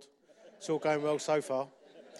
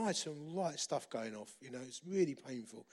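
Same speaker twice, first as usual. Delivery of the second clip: usual speaking voice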